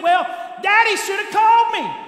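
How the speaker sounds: mock whiny complaint. Preacher mocking churchgoers who blame God, loud whiny cry. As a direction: shout it like a sulking child. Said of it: "we think".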